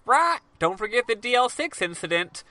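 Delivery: high parrot voice; squawk, then recite in a high-pitched bird voice. Retold or imitated. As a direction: parrot parroting a learned phrase. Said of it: "the parrot said".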